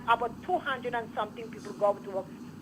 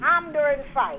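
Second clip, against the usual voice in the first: speaking up; 6 semitones higher, 6 dB louder, slower than usual. Real, not acted. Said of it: "Speak up, speak up".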